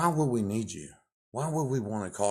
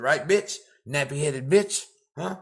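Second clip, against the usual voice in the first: angry taunt; 5 semitones higher, 6 dB louder than usual. Real, not acted. Angry rant at caseworker.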